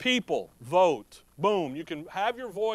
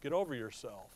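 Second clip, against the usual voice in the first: retold brush-off; 6 semitones lower, 8 dB quieter than usual. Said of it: "I'm like".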